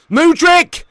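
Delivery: loud, high burst of commentary; excited shout. Real, not acted. Streamer reacting live to the match, excited shout. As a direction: yell the player's name as he attacks.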